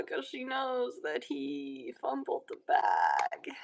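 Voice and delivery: unvoiced, hushed whisper; whispered gossip. Real, not acted. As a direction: whisper it like a juicy secret. Gossiping aside about the ex.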